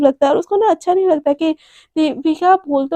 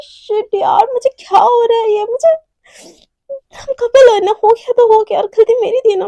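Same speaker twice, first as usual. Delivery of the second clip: high, loud, tearful self-scolding; scolding herself; 7 semitones higher, 4 dB louder than usual. Acted. Devika cursing herself over the kiss, high tearful self-scolding. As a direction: berate yourself close to tears. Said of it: "खुद को दिल ही दिल में गाली दे रही".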